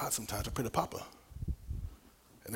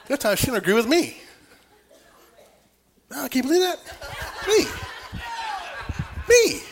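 loud, high, laughing incredulity; mock disbelief; 7 dB louder than usual. Husband jokes that his wife disagrees with him, high mock disbelief. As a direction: squeal in comic outrage at the very idea.

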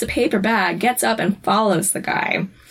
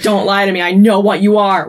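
stern accusation; 6 dB louder than usual. Retold or imitated. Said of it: "He's like".

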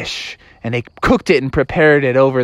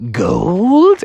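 very slow, drawn-out question; gold-struck dad; much slower than usual. Acted. Froggy's dad hears of gold, slow entranced question.

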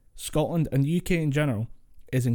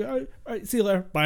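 higher, imitated quick phone goodbye; phone sign-off; 8 semitones higher, much faster than usual. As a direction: toss off a mock goodbye down the line. Acts ending the phone call.

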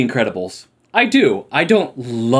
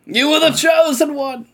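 anguished shout. Acted. Quoting a film line dramatically.

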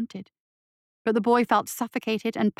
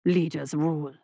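dismissive; 6 semitones lower than usual. A narrator's reading. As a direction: brush it off like a contemptuous beast. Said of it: "said Zhenbu dismissively".

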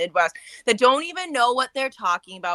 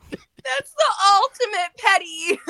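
cracking up, high and loud; laughing the words out loud; howling with laughter; 7 dB louder, slower than usual. Real, not acted. Laughing hard at story.

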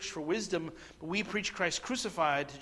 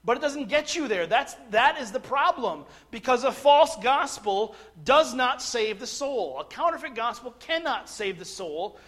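raised, higher-pitched preacher's voice; emphatic preaching; 5 semitones higher, 7 dB louder than usual. Real, not acted. Preacher warning congregation that false gospels cannot save, emphatic preaching. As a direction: preach it forcefully, driving home each key word.

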